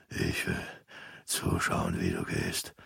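slow, soft dying rasp; rasping whisper. A narrator's reading. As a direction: pull him closer with your last breath. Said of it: "wiederholte Opa". (langsames, heiseres Sterbeflüstern)

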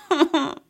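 high, hysterical laugh close to sobbing; sob-like laugh. A narrator's reading. High-pitched laugh like weeping. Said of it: "Смех её был похож на рыдание".

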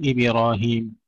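"إبراهيم" said as ibi-rahim; mistaken i after ba. Shown as a demonstration. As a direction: slip a short i after the ba in "إبراهيم". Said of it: "بعضهم يكسرها".

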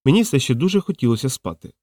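usual speaking voice

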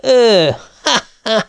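high, loud scornful "ha, ha"; scornful laugh. A narrator's reading. Husband scoffing at wife, loud mock laugh. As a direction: laugh her off in disbelief.

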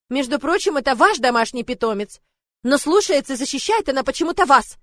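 shouted reply. Acted. Lord retorting through the door, loud.

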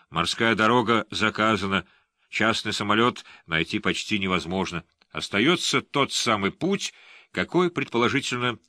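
ordinary delivery, nothing marked

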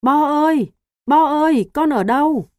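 loud, high frantic call; calling loudly. A narrator's reading. Frightened father calling for his missing son, frantic shout. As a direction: shout his name, panic rising. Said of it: "Anh gọi to".

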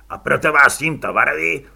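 loud official warning through a megaphone. A narrator's reading. Officer warns the rioters through a megaphone, loud and official. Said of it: "zvedl megafon znovu".

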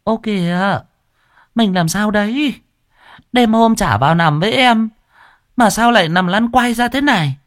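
high, sugary, mincing wife; simpering voice. Acted. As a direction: coo it with fake concern. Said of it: "dùng cái giọng ẻo lả".

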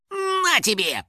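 high-pitched burst of effort; attacking cry. A narrator's reading. House spirit hitting the door with a paw, attacking cry. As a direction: throw it out high with the blow. Finik strikes door at a run.

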